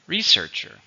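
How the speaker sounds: emphatic model of Researcher; stress model. Shown as a demonstration. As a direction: punch the second syllable of Researcher, re-SEARCH-er. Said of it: "Where is the stress?".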